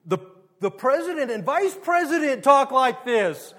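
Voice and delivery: mimicking kids. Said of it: "your kids will say back to you".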